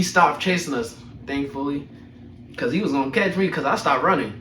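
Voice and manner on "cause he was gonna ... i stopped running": ordinary talk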